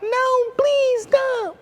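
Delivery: very high, slow drawn-out whine; hammed-up toddler whine; whining child. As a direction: mimic a crying kid pleading. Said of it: "whining and crying about".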